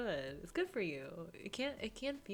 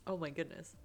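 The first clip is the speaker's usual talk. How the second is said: ordinary talk, nothing marked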